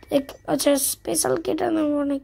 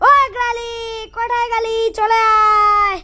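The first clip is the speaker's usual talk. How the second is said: teasing call; 5 semitones higher, slower than usual. Real, not acted. Coaxing Granny with a loud call.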